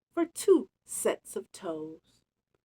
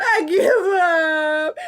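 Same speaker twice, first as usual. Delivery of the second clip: slow, high, mock sobbing wail; hammed-up crying bear voice; blubbering; 8 semitones higher, 8 dB louder, slower than usual. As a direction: ham up a bear blubbering in defeat. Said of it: "He blubbered".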